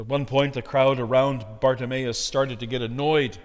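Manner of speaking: ordinary delivery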